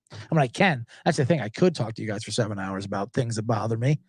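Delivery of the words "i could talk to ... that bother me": much lower and softer, tossed off; quiet first take before the punchline; low aside. Real, not acted. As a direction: mumble it half to yourself. Repeats the phrase louder next.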